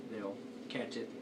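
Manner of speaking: normal talking voice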